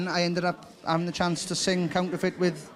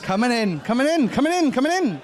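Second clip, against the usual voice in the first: excited announcing; 4 semitones higher, 3 dB louder than usual. Acted. Announcing guests arriving.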